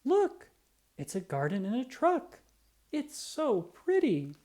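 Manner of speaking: put-on high bright child's voice, slowing at the end; a small girl delighted by the truck; little girl. Small girl tells her mother about the truck, high and delighted. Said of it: "a small girl told her mother".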